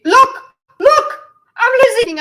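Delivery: sudden high excited cry. Real, not acted. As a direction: call out, thrilled and high. Excited spotting a glitch.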